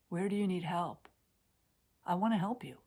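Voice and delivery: ordinary talk, nothing marked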